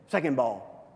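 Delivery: ordinary talk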